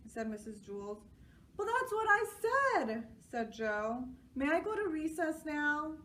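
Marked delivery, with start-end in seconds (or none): 1.6-3.1 put-on high, loud boyish insistence; child voice. A narrator's reading. Joe insisting. 4.3-6.0 put-on high eager boy's question; child voice. A narrator's reading. Joe asks.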